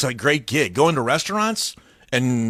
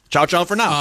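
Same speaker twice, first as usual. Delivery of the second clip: imitated sign-off; 5 semitones higher, 3 dB louder than usual. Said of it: "his sign-off".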